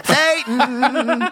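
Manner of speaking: mock church lady cry. Mock dramatic Satan cry.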